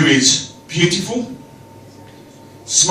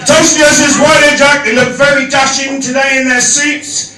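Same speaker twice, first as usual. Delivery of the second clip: loud toast; 10 semitones higher, 5 dB louder than usual. Real, not acted.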